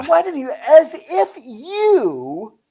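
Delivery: scornful disbelief. Retold or imitated. Man arguing she couldn't arouse him, scornful disbelief. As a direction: stretch YOU out high in retold scorn.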